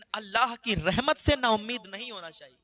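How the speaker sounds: preacher exhorting listeners to sincere repentance, fervent; fervent preaching. Acted. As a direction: urge the listeners never to lose hope. Preaches to audience.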